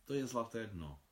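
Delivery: musing. A narrator's reading. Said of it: "přemýšlela Marie".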